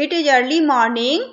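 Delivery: put-on slow, clear reading to children; slow reading aloud. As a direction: read each word out slowly for the class. Teacher reading the text slowly.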